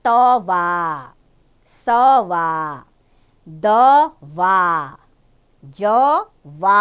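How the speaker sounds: तवा, दवा with the final आ stretched long; very slow demo words. Shown as a demonstration. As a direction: draw each word out slowly. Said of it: "आ का sound".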